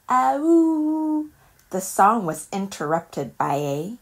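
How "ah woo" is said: high, louder sung howl; dog howl. Acted. Olive howls the last note with Dexter. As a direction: throw your head back and howl. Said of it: "she howled".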